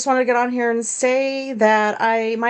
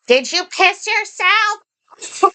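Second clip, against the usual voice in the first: high, slow put-on voice of the nosy neighbor; imitating the neighbor; 9 semitones higher, much slower than usual. Mimicking the neighbor calling across the street, high and slow. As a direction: drag it out high like the odd lady across the street. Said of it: "she just goes".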